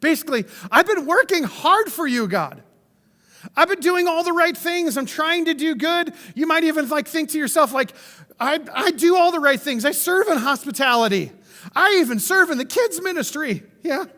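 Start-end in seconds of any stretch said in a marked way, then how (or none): 0.7-6.1 higher, self-pitying plea; whiny voice. Retold or imitated. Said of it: "we get that whiny voice". 8.4-13.6 higher, self-pitying plea; whiny voice. Retold or imitated. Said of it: "we get that whiny voice".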